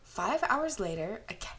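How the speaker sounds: ordinary delivery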